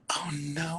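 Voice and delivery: breathy whispered oh no; hushed dismay at the ruined CD. Retold or imitated. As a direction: whisper it in dawning horror. CD scratched during talent show.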